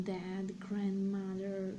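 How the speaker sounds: usual speaking voice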